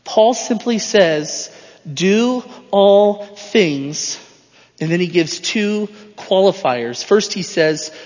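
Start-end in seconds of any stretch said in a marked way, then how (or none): 1.9-4.2 each word stretched out very slowly; slow emphatic quote. Acted. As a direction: lean on every word, slowly. Quoting Paul's command, drawn out.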